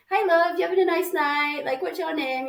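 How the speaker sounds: high, chatty Northern English greeting; Northern English bird. Acted. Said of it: "Northern English bird who's just gonna be like".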